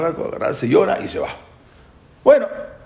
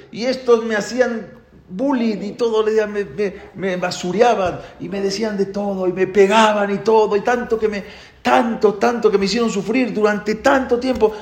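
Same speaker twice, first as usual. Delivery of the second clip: eagle's realisation; 7 semitones higher than usual. Acted.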